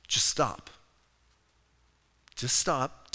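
low, soft instruction with long pauses; calming. Shown as a demonstration. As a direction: settle a panicked listener, quietly. Calming people who are freaking out.

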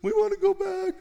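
high whining mock outrage; high-pitched mocking protest; whiny complaint. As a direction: whine at Moses like spoiled kids. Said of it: "They're screaming and yelling at Moses".

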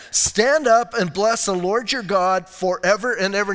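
usual speaking voice

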